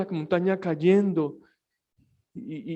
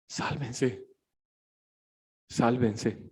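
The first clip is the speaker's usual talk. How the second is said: quiet plea; 6 semitones lower, 9 dB quieter, much slower than usual. Pretend. Quiet plea to one's family.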